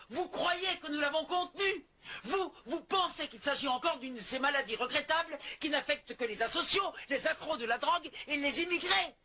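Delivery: rising from firm to a high, heated shout; growing anger. Acted. Warning the mayor the epidemic is not contained, furious rising shout. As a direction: hammer the mayor with mounting fury until it bursts.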